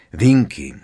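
loud, firm call of a name; brisk, firm. A narrator's reading. Hermiona addresses the sobbing elf, firmly. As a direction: cut in briskly. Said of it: "řekla Hermiona rázně".

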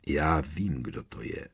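calm, low and soft at the end; klidný hlas. A narrator's reading. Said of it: "řekl klidným hlasem". (klidným, tlumeným hlasem)

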